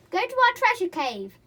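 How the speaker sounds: high, loud command; triumphant shout. A narrator's reading. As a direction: order the chair off. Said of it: "they shouted".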